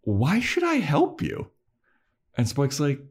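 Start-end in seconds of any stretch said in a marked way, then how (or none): 0.0-1.5 high, slow question rising at the end; passive-aggressive. Retold or imitated. Giles with arms crossed questions Spike, passive-aggressive. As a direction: toy with them, voice lifted in mock inquiry. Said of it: "passive aggressive".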